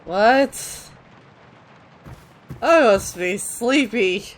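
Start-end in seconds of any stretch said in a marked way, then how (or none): none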